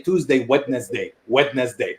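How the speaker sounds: "Wednesday" with every letter sounded, Wed-nes-day; spelled-out Wednesday. Retold or imitated. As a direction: read the days the way they are written, Wednesday as Wed-nes-day. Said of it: "going Monday".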